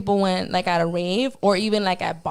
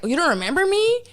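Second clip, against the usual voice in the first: higher-pitched incredulous question; imitating insistent stranger; 7 semitones higher, faster than usual. Stranger to Reverie at photo shoot, high incredulous question. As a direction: act put out, pitch climbing.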